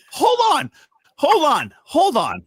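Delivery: high, loud cry stepping down in pitch; insistent interjection. Real, not acted. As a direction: cut in hard to halt everyone. Cutting in to object.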